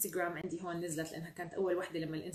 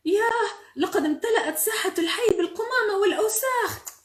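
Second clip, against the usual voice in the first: high, loud dismay; hammed-up storybook astonishment; shocked exclamation; 13 semitones higher, 11 dB louder than usual. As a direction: cry out at a horrible mess. Shock at square full of garbage.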